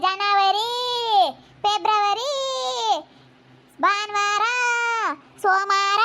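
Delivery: drawn-out classroom recitation, lifted in pitch; mimicked rote teaching. Imitating old Susheela's months-and-days lessons, singsong recital. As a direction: chant each word as in a rote lesson. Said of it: "ಅಂತ ಹೇಳ್ಕೊಡೋದು ಬರೀ".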